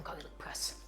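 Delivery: whispered aside to the viewers; hushed and slow, leaning in to the camera. Real, not acted. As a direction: let them in on a secret, in a whisper.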